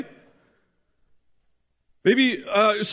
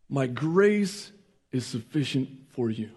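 hushed repeat; 8 semitones lower, 6 dB quieter than usual. Shown as a demonstration.

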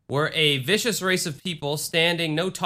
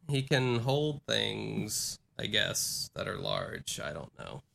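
trailing off softer and lower; out of steam; 5 semitones lower, 10 dB quieter, slower than usual. Real, not acted. Host giving up on a weak joke, running out of steam. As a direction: let the joke peter out, much softer. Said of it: "I have run out of steam".

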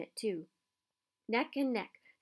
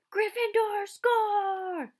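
much higher and louder, stretched out slowly; excited goal call; 12 semitones higher, 7 dB louder, much slower than usual. Acted. Commentator calling the goal to the crowd, stretched excited shout.

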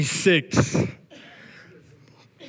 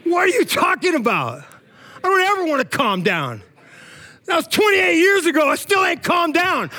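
excited protest; 11 semitones higher than usual. Retold or imitated.

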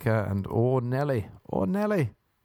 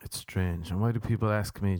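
ordinary talk, nothing marked

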